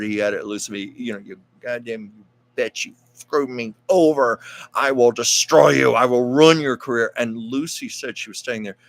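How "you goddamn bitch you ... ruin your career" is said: a furious boss's yell, imitated and building louder; mock yell. Studio boss Harry Cohn threatening Lucy, in a mock furious yell. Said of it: "he was screaming every swear word".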